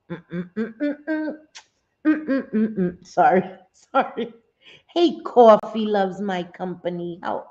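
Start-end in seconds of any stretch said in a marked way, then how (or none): 0.1-3.1 high cooing hum turning into a laugh. Real, not acted. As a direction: coo at the pet, then laugh. Cooing at pet.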